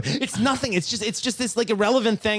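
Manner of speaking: high, worked-up retold insistence; exasperated pleading. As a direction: plead at a high pitch, losing patience. Exasperated pleading inside retold quarrel.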